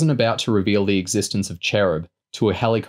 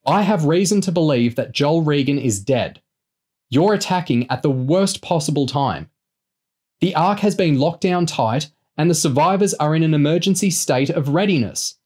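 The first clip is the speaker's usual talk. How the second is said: high, urgent, raised voice; frustrated warning, pitched up; increasingly exasperated; 7 semitones higher than usual. A narrator's reading. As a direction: argue hard to make them listen. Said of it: "growing increasingly exasperated".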